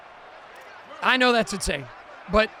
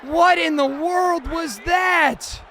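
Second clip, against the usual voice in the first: slow, higher, louder disbelief; stunned disbelief; 7 semitones higher, 3 dB louder, much slower than usual. Real, not acted. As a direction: drag each word out in shock. Stunned after a missed interception.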